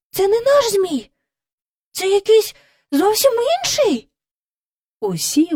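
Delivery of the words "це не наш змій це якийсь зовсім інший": високо, схвильовано; дівчинка в подиві кричить про змія. A narrator's reading. Діти бачать чужого змія. (схвильовано й високо)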